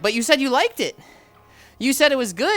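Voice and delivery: high, loud mock whining objection; retold protest. Nephew defending himself to angry uncle, protesting. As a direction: protest loudly with pitch climbing.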